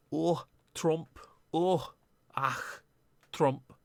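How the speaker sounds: lightly done Scottish accent, mocking the MP; a half-hearted Scottish accent, whining about Trump; rough Scottish accent impression of the MP. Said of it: "I know I don't do a Scottish accent".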